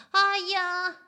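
high, loud yelp of pain; a sharp little cry; ouch. Acted. Otter Amalia yelps when the boat crashes.

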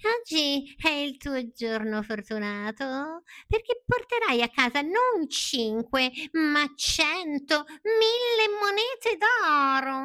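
high, slow tempting cat voice, rising at the end; scheming cat. Acted. Cat luring Pinocchio with riches, slow and rising. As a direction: dangle the promise slowly.

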